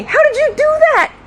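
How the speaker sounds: very high, loud astonished question; amazed question. Retold or imitated. Partner asking officer about the arrest, astonished cry. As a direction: ask it in exaggerated amazement. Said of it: "Doug asked me".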